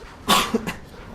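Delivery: dry cough. Real, not acted. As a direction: cough from a strained throat. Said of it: "I'm losing my voice".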